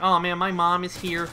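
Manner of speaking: a hint of a plaintive movie heroine; voiced movie daughter. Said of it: "she's all like".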